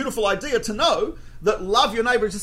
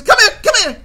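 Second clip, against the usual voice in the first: high, loud, pushy; loud urgent calling; 14 semitones higher, 11 dB louder than usual. Retold or imitated. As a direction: yank him along by voice. Waiter drags him away.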